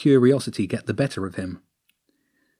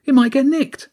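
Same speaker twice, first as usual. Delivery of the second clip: calling out; 6 dB louder than usual. Acted. Thief continues calling to lookout.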